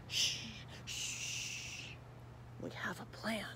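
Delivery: ordinary delivery, nothing marked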